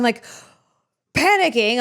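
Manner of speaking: normal talking voice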